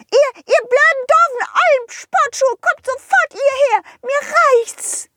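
very high furious fairy yell. Acted. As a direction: shriek at the shoes in anger. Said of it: "weil du sie so anbrüllst". (wütend brüllende Fee)

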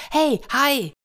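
put-on high-pitched eager boy's call; excited calling out. Boy jumps up and calls after the shark, calling out. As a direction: call out to catch someone's attention.

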